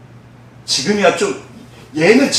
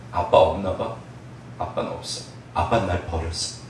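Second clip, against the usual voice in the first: put-on soft, low child murmur; a child deciding dad is gone, quiet and low; despairing child; 10 semitones lower, 10 dB quieter than usual. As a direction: let the hope drain out. Abandoned child gives up hope.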